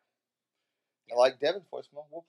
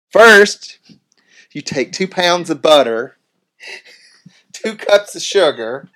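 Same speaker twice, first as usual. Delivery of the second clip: very loud, high, slow Southern cook; loud, high Southern cooking-show drawl; Paula Deen impression; 7 semitones higher, 17 dB louder, slower than usual. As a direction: drag out the recipe like a hammed-up TV host. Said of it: "It's Paula Deen".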